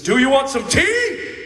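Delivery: loud, high, slow yell with TEA in caps; angry shout. Shown as a demonstration. Father showing the congregation how anger asks, furious shout. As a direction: bellow "tea" like anger from the movie. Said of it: "He says".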